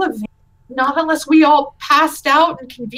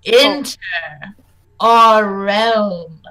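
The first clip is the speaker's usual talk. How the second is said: loud, booming spectral voice demanding who intrudes; a ghost roaring from the fog at trespassers; booming ghost challenge; 3 semitones lower, 6 dB louder, much slower than usual. Acted. Ghost twins challenge the party in a loud booming voice.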